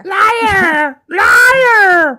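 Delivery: hammed-up, very high heckler's shriek; imitated yell. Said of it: "Marjorie Taylor Greene yelled".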